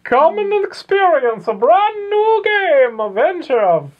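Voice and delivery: very high, loud, drawn-out hawking cry; shrill-pitched, slow old shopkeeper's call; old shopkeep. Acted. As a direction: holler your wares like a hammed-up old shopkeep. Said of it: "The old shopkeep".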